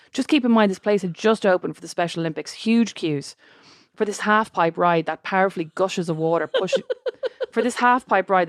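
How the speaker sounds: normal talking voice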